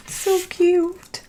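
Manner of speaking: high, soft coo over the craft images; cooing "so cute". Real, not acted. Crafter admiring her stamped images to viewers, soft high coo. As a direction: coo at it like something adorable.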